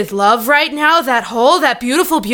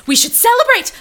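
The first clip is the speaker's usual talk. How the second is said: excited squeal; 12 semitones higher, 4 dB louder than usual. Acted. Giddy over getting his number.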